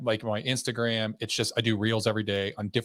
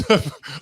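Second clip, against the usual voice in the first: quick laugh; 4 semitones higher, 6 dB louder than usual. Real, not acted. Laughing at his own joke.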